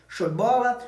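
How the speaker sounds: ordinary talk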